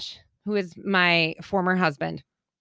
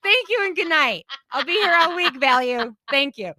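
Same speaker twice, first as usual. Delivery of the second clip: higher, hammed-up comedian taking a bow; milk the applause like a lounge comic; mock stand-up sign-off; 7 semitones higher than usual. Playing to the laughing store clerk, hammed-up comedian thank-you.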